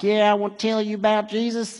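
light mock of a plain-spoken preacher; mocked folksy preacher. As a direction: lightly play the unpolished preacher they laughed at. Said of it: "'Cause he's just like".

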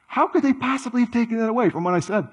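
high, rushed incredulous protest; exasperated disbelief. Acted. Misunderstood person protesting about what they said, exasperated disbelief. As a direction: blurt it out high and fast, baffled. Acts out being misinterpreted.

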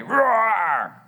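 big snarling bear roar, imitated. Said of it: "confronted by a snarling bear".

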